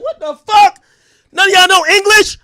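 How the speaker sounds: high, loud frustrated shout; exasperated yell. Retold or imitated. Streamer yelling at a call center agent, loud and high, exasperated. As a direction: yell at the phone in disbelief.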